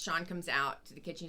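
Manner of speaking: normal talking voice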